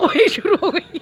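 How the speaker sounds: high laughing exclamation; amused high laugh through the words. Real, not acted. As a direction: burst out laughing as the dog starts barking. Dog starts barking.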